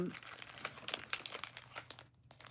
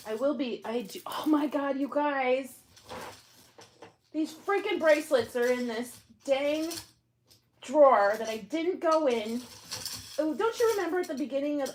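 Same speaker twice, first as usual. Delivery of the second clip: higher, rising exasperation over the bracelets; oh my God you guys, half-laughing disbelief; exasperated squeal; 7 semitones higher than usual. Real, not acted. Exasperated find in the drawer.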